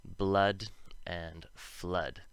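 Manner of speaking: Blood and flood said slowly with a short U; slow model words. Shown as a demonstration. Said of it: "two O's make the short U sound".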